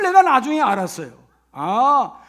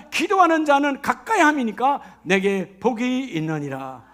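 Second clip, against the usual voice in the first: loud, driving sermon voice; impassioned pulpit delivery; fervent preaching; 3 semitones higher, 4 dB louder than usual. Acted. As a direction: preach it out to the congregation.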